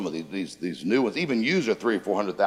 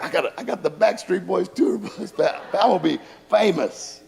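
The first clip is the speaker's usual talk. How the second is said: excited boast; 7 semitones higher than usual. Retold or imitated. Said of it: "I'm like".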